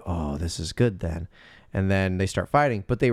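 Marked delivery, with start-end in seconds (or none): 0.1-1.3 sly villain. Retold or imitated.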